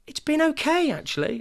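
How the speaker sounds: very high, bright reassuring cover; teacher covering up. Retold or imitated. Parent imitating the nursery teacher glossing over a rough session, very high and chirpy. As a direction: chirp it too brightly, hiding a bad day. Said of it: "How'd you get on?".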